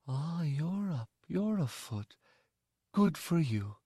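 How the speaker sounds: kindly. Acted. The mother greets the injured boy, soft and kind. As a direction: praise him gently. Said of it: "she said".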